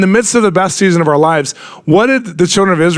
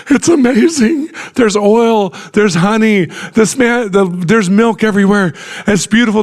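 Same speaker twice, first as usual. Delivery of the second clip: much higher, gushing with wonder; excited spies; 7 semitones higher than usual. Retold or imitated. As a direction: rave about the land like an excited believer. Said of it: "Joshua and Caleb who are like".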